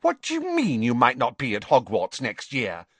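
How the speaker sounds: high, indignant schoolgirl; affronted, starting very high. A narrator's reading. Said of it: "said Pansy indignantly".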